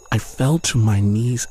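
plain reading voice

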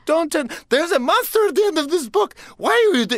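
shrieking Grover warning; frantic Grover. Retold or imitated. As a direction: panic at the reader turning the page.

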